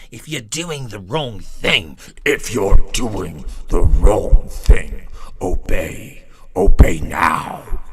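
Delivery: deep mocking command turning into a roar; mock authoritarian voice.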